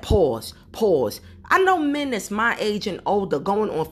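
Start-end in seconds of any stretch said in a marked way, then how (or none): 0.0-1.2 mocking dude. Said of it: "men that don't go around saying all that".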